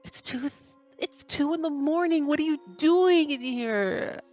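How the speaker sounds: whiny protest. Acted. Woken kid protests to the intruder, high whine. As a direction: whine it high, stretching the question out.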